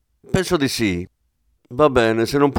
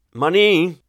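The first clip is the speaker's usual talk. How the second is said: called out; 7 semitones higher than usual. A narrator's reading. Aldani calling his inspector from his office, raised call. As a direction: call Manin from the next room. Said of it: "Urlò Aldani dal suo ufficio".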